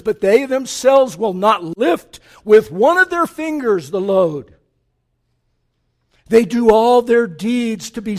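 loud, thundering scripture rebuke; preaching at full force. Acted. Preacher voicing Jesus denouncing the scribes and Pharisees, thundering. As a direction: hurl the rebuke at the hypocrites.

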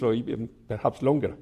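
ordinary delivery

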